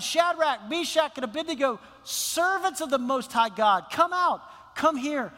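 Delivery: commanding shout; king shouting. Acted. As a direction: call them out of the blazing furnace.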